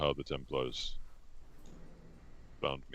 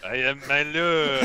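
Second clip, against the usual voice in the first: put-on thick French accent, slow; 11 semitones higher, 12 dB louder, slower than usual. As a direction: say it like a French chef. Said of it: "just adopt your accent".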